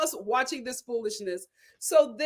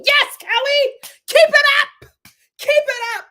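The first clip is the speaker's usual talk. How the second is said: cheering shout; 12 semitones higher, 9 dB louder than usual. Real, not acted. Cheering Kelly on.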